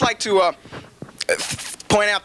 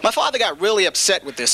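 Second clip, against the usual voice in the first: usual speaking voice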